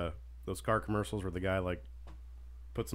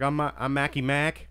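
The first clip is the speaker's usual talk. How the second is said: cocky [name]; 5 semitones higher, 6 dB louder than usual. Retold or imitated. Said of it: "He's like".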